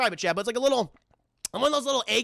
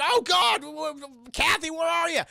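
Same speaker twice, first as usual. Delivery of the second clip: panicked calling; 7 semitones higher than usual. Retold or imitated. Everyone searching for Kathy.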